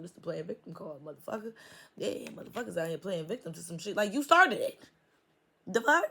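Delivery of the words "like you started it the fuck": high and loud, indignant; indignant outburst. Real, not acted. Reader scolds people playing victim, loud and high. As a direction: throw it back at them, outraged.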